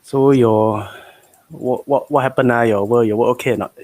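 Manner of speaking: casual Singlish with lah and lor; easygoing Singapore street English. Acted. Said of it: "I'm gonna try and speak some Singlish".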